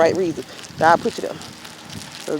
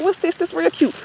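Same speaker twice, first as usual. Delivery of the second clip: playful coaxing; 8 semitones higher, 3 dB quieter than usual. Retold or imitated. Coaxing old man to press button.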